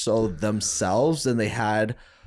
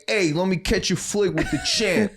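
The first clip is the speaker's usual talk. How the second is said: higher, hammed-up New Jersey accent; 8 semitones higher than usual. Jersey fan wanting a photo with the champ, New Jersey accent. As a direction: play a Jersey local, broad accent. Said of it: "He's like".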